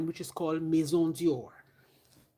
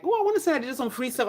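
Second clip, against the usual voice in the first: imitating brand rep; 7 semitones higher, faster than usual. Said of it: "I wanna be like".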